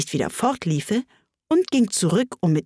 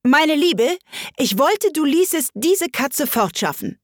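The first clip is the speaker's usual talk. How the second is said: calling out; 8 semitones higher than usual. Acted. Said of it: "rief der Königin zu".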